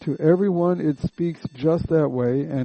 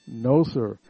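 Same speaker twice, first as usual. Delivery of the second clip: usual speaking voice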